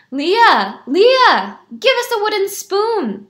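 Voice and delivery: loud, high urgent call; crying out. A narrator's reading. Crowd at the house next door, loud high cry. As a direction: call to a neighbor through the door. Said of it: "they cried".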